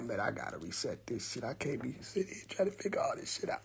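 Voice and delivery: very soft talking to himself; acted-out grumble under the breath; muttered inner thought.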